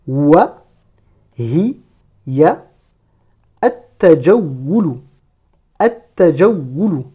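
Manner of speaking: isolated Arabic words with pauses; slow model reading for learners; detached word-by-word. Shown as a demonstration. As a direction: read one word at a time, deliberately. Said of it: "chaque mot de manière détachée".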